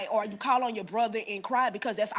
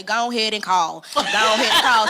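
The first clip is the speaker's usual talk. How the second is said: louder, laughing mock of mother; mother's loud urging; 9 dB louder than usual. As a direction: bark it like a pushy mom, half-laughing. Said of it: "mother gonna say".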